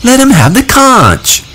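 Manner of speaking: put-on high, pushy shout for the little boy; a boy shouting across the assembly. A narrator's reading. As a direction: yell it over the crowd's laughter. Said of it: "shouted Piggy".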